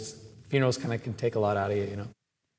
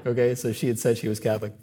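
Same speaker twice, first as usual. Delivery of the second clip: normal talking voice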